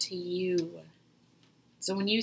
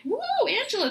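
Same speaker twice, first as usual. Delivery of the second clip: much higher, louder "woo"; self-realization whoop; 14 semitones higher, 5 dB louder, faster than usual. Retold or imitated. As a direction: catch yourself with a startled whoop. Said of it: "I'm like".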